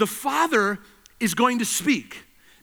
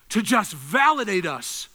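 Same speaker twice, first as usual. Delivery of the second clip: ordinary talk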